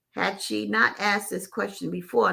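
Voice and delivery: plain reading voice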